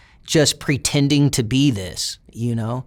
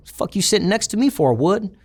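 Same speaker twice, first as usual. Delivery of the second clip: imitated challenge, pitched up; mock-indignant challenge to a seatmate; confrontational challenge; 7 semitones higher than usual. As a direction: retell it as a pointed, higher-pitched demand. Acted hostile challenge, corrected wording.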